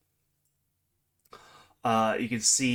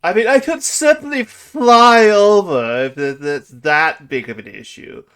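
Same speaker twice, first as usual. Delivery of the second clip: grand theatrical dragon; 6 semitones higher, 15 dB louder than usual. Acted. Dragon offers to fly over the traps, loud and lofty. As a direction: offer it loftily in a big voice.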